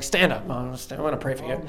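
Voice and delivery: low, quiet, reluctant request; grudging, half-hearted offer; begrudging. Retold or imitated. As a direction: mumble it low like you'd rather not.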